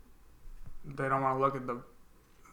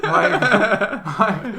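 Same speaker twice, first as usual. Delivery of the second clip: loud high laughter through the words; laughing loudly; 8 semitones higher, 16 dB louder than usual. Real, not acted. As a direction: burst out laughing mid-sentence. Laughing at the friend's joke.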